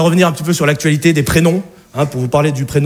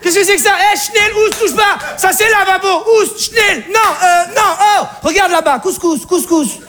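panicked Alsatian mother; 13 semitones higher than usual. Acted. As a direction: shriek to shoo him away. Alsatian mother chasing him off.

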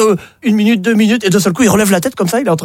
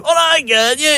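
higher rowdy cheer; rugby lads cheering; 9 semitones higher than usual. Acted. The rugby team arriving in the café cheers, higher.

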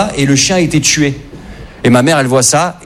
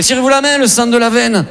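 much higher, pleading mediator; playing peacemaker; 7 semitones higher than usual. Son caught between his silent parents, high pleading mock appeal. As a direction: plead with both of them, pitched way up. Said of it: "je fais".

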